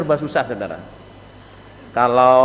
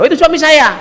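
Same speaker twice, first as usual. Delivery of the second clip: high, loud, brusque reply; acted coarse-mouthed wife, pitched way up; rude wife; 10 semitones higher, 5 dB louder, faster than usual. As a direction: blurt it rudely at the visitor. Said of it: "bahasanya cewek ini jangan kau wasar".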